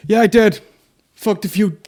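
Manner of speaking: loud, high attempt at Trump's American accent. Comic imagining Trump owning up, attempted American accent. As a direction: have a failed go at a brash American accent. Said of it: "I can't even do the accent".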